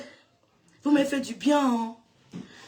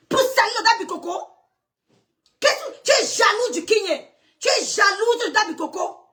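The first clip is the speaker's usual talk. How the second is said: high, shouted jealousy accusations; furious accusation; 5 semitones higher than usual. Real, not acted. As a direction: hurl it at them, outraged. (accusation furieuse et criée)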